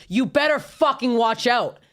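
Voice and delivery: mildly menacing mock warning, drawn out; retold threat. Girlfriend's threat acted out.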